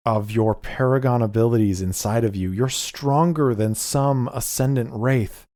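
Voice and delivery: desperate plea, building. Acted. Lucius pleading with possessed Adelie, rising to a desperate please. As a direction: build from refusal to begging. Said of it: "and he says".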